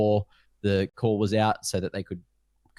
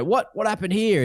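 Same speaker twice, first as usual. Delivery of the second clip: much higher, baffled mock question; surprised; 11 semitones higher than usual. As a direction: imitate a confused presenter. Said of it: "they go".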